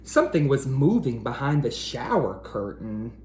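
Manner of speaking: usual speaking voice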